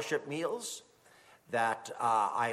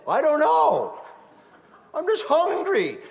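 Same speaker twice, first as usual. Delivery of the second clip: imitating a crying child; whiny child; 12 semitones higher, 7 dB louder than usual. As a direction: whine like a hungry little kid. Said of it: "Your children comes to you crying".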